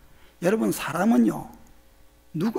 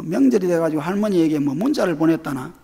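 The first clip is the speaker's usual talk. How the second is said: ordinary talk, nothing marked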